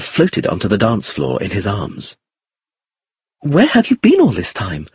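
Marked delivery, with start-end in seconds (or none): 3.5-4.8 higher, curious question; lightly voiced Iris. A narrator's reading. Iris to her returning dance partner, curious. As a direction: ask it high, with light curiosity.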